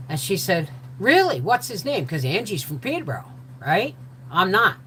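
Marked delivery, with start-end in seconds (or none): 1.0-2.1 higher, eager question; eager friend. Retold or imitated. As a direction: lean in eagerly. Angie eager.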